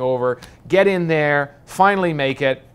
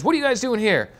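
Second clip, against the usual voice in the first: excited Texan general; 10 semitones higher, 3 dB louder, faster than usual. Retold or imitated. Retold general from Texas talking.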